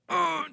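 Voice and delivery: a high, forced grunt of trying to cry; straining grunt. Retold or imitated. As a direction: strain to squeeze out a tear. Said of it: "I was like".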